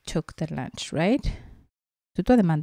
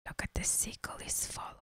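whisper; 20 dB quieter, much faster than usual. Real, not acted. Whispered aside to child.

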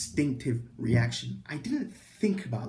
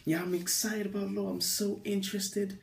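higher-pitched fake keenness; feigned enthusiasm; 6 semitones higher, 4 dB quieter than usual. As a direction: put on interest you don't have. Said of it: "I was bullshitting like a mother".

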